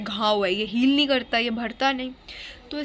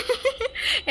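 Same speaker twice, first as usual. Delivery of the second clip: high-pitched giggle; squeaky amused laugh; real laugh; 12 semitones higher than usual. Real, not acted. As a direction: burst out laughing at yourself. Laughs at her own impression.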